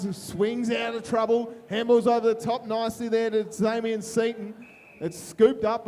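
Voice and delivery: play-by-play commentary. Acted. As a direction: call the footy action live. Live footy commentary.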